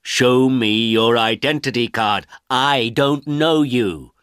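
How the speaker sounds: an official turning a stranger away in anger, angry and slow. A narrator's reading. Angry official refusing an old man, slow and stern. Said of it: "The official looked angry and said".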